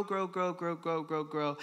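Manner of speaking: evenly repeated, gradually fading; rapid repetition. Shown as a demonstration. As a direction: rattle off each grow in an even chain, tapering down. Counting off the body's growth.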